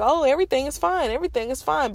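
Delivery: high, quick brush-off, imitating the doctors; mock breezy doctor. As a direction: toss it off like nothing's wrong. Doctors brushing off her pain.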